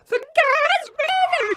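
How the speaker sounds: put-on very high, slowed panicked child's scream. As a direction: wail it from the falling car. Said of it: "Eddie screaming out the window".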